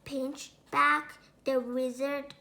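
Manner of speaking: plain reading voice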